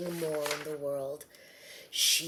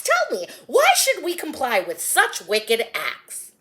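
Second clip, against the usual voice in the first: loud excited greeting; 3 semitones higher, 11 dB louder than usual. Acted. Crowd man hails Joseph, complains.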